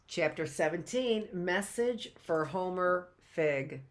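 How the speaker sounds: slow, formal reading of "Chapter seventeen"; reading out the chapter heading to listeners, formal; chapter title announcement. Shown as a demonstration. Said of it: "chapter seventeen, and continue".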